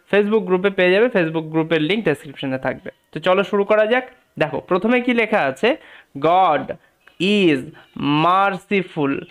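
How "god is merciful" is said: slow, separated words for learners; word-by-word reading. Shown as a demonstration. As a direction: read it out slowly, pausing after each word. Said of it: "ভেঙে ভেঙে উচ্চারণ করার চেষ্টা করি". (ভেঙে ভেঙে ধীরে পড়া)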